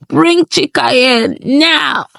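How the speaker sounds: high, slow, drawn-out order from an elder; angry command. A narrator's reading. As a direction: demand it of the whole hall, stretching each word. Angry elder slams stick, commands.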